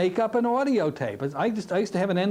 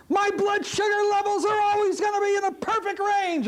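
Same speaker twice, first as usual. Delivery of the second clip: mock scream, much higher; client screaming. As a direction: imitate the client yelling out the suggestion. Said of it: "you hear this voice screaming".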